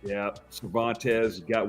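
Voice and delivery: usual speaking voice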